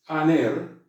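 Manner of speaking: "aner" with a plain open A, no breath; Greek word aner. Shown as a demonstration. Teacher models the Greek "aner" for students. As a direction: say "aner" cleanly from the vowel. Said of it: "la palabra aner".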